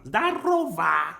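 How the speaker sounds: slow, drawn-out Zdarova with hammed-up force; mock fierce greeting. Joking that hello sounds like fighting, mock fierce. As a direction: stretch out Zdarova like a mock war cry.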